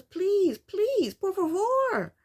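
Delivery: high-pitched mock pleading, last words drawn out; begging. Boyfriend begs to come back to the house, mock pleading. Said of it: "And he's begging her".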